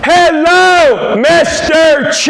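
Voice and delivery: mother-in-law greeting dad as if he can't understand; mother-in-law loudly speaking "Korean". Retold or imitated. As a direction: overdo a loud slow hello to a foreigner. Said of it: "she thinks she's speaking Korean".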